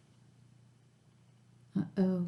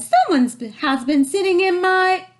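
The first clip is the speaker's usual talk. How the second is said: high, loud mother bear voice; 11 semitones higher, 5 dB louder than usual. A narrator's reading. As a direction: raise your voice as Mama Bear. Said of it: "Mother Bear said".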